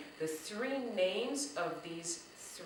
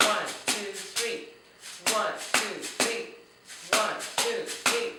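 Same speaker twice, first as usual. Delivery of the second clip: loud counted beats; counting beats; 5 dB louder than usual. Shown as a demonstration. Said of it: "Let's go".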